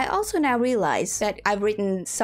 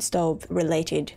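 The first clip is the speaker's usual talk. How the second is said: ordinary talk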